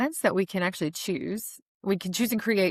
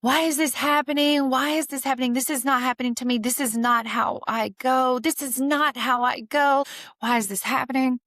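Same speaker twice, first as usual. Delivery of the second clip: yelling at God; 6 semitones higher than usual. Retold or imitated. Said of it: "I was yelling at God".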